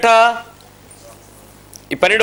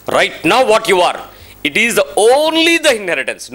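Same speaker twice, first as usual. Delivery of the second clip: slow emphatic; much slower than usual. Real, not acted. Slow deliberate English emphasis.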